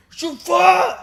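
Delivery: loud high shout of the name; yelling a name. Retold or imitated. Friend pounding on the door, shouting. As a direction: bellow it through the door.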